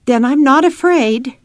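a hint of a plucky little girl; Ada. A narrator's reading. Child reassures her father, lightly voiced and resolute. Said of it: "she said".

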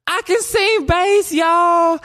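loud, hammed-up high-pitched call; high excited holler. Walking into choir class announcing a bass, high holler.

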